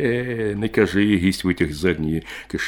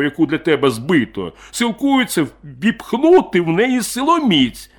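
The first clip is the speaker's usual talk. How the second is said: higher and louder aggrieved rant; bitter drunk miner; 9 semitones higher, 7 dB louder than usual. Acted. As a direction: pour out a grievance over vodka. Drunk miner's long monologue.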